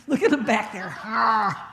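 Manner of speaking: loud laugh through the words; real laughing. Real, not acted. Speaker teasing Bob at the back, real loud laughter. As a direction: crack up and call it out loudly.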